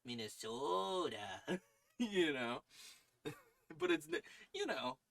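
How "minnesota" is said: slow, stretched Minnesota "Ooh, Minnesota"; Minnesota accent. Shown as a demonstration. Demonstrating the nasal Minnesota accent, slowly. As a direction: sing out "Minnesota" with a long nasal o. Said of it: "you pitch it up into your nose".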